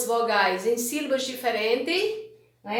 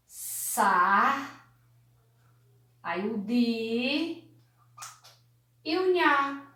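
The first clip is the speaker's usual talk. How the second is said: SAR, DI, NHA with long pauses; syllable by syllable; much slower than usual. Shown as a demonstration. Teacher writing out the word sardinha, slow syllables. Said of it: "A palavra".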